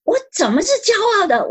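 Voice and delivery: high, fast, baffled retort; shocked protest at being called proud; indignant surprise. Retold or imitated. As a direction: blurt it out in disbelief. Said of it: "我说".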